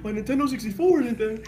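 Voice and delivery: high, loud disbelieving echo of the news; shocked disbelief. Retold or imitated. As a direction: cry it out like a stunned kid. His shocked echo as a kid.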